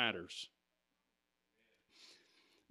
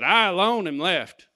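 Elijah's lament proclaimed loudly; loud declaration; 3 semitones higher, 8 dB louder than usual. Shown as a demonstration. Preacher proclaiming Elijah's words, loud. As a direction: call it out to the whole room. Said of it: "Elijah just said".

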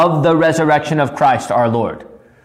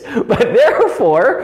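laughing; 3 semitones higher than usual. Real, not acted. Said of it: "I don't know why I'm talking like this".

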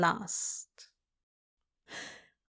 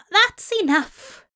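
hoarse, higher and louder; 12 semitones higher, 5 dB louder than usual. Acted. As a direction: force it out hoarsely. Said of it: "he said hoarsely".

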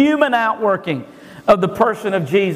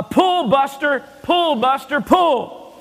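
slow, higher mock call to a horse; mock yell; 7 semitones higher, slower than usual. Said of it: "he starts yelling".

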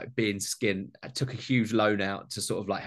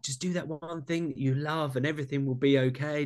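mocking sugarcoated advice; 5 semitones higher than usual. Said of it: "he lays on this story".

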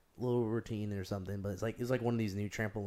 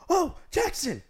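much higher, louder frightened cry of Jackson; panicked mom's scream; 7 dB louder, slower than usual. Retold or imitated. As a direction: scream the name like a mother watching her son fall. Mom screams as he falls.

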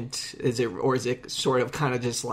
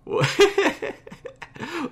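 high burst of laughter. Real, not acted. Laughs at friend's comment.

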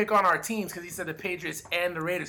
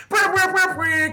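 high rolled brr like a bird; high trilling animal noise; 11 semitones higher, 4 dB louder than usual. Acted. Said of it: "What the actual fuck is that supposed to be".

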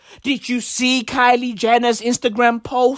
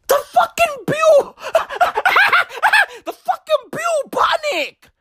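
high-pitched squeal breaking into laughter; laughing incredulously. Real, not acted. Ranter reacting to the bubonic plague's return, laughing in disbelief. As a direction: laugh helplessly through the words, pitched way up.

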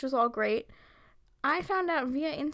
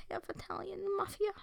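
mock whisper; 6 semitones higher, 10 dB quieter than usual. Hushed to co-host about her mafia roots, mock whisper. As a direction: hush it like a mob secret.

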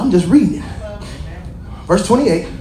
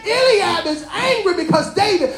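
full-force preaching; 8 semitones higher than usual. Acted. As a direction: drive the message home to the congregation.

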